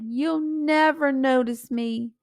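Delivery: elder son hurt before his father; hurt plea. Acted.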